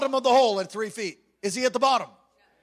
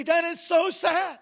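high, drawn-out slow exclamation; slow, raised lament; emphatic lament; 8 semitones higher, 4 dB louder, slower than usual. Retold or imitated. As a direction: stretch it out, pitched high. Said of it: "all I could do is just say".

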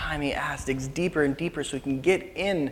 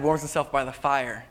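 ordinary talk, nothing marked

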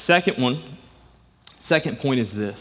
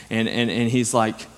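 ordinary delivery

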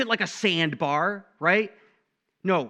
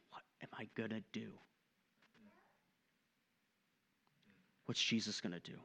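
low, very soft wondering to oneself; quiet inner thought; 4 semitones lower, 14 dB quieter, faster than usual. Acted. Person alone at home at night wondering what to do, hushed. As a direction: think it aloud, hushed and low.

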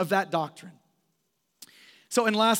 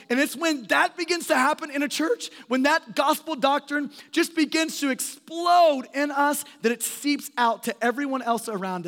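rising fervor; 6 semitones higher than usual. Real, not acted. Preacher building to climax.

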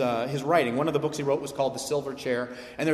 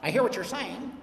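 mock skeptic voice; 3 semitones higher than usual. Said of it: "who might be like".

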